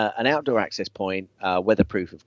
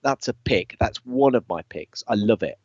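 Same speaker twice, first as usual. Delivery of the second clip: normal talking voice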